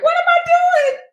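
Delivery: very high retold squeal; panicked realization. Acting out sudden regret on ride.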